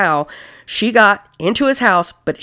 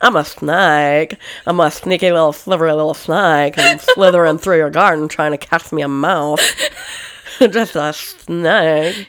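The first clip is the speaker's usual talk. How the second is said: sneaky snake voice; 3 semitones lower than usual. Pretend. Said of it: "How does that go again?".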